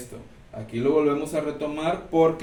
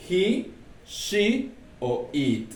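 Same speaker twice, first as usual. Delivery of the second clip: slow emphasis; 4 semitones higher, much slower than usual. Shown as a demonstration. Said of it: "teacher stresses the exceptions".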